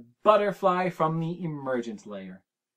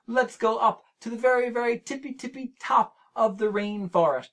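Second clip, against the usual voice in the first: higher and quicker, climbing with delight; excited build; 6 semitones higher, 3 dB louder than usual. Real, not acted. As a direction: lift the voice as if climbing to the top.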